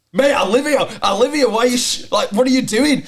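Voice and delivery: high, loud, incredulous outburst; fed up, almost laughing; exasperated retort. Retold or imitated. Lad snapping back at the director, loud and exasperated.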